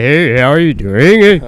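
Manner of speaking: slow, mock Homer Simpson greeting; slow lazy cartoon-dad hello; Homer Simpson impression. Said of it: "a bunch of Homer Simpsons".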